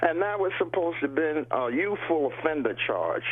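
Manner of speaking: normal talking voice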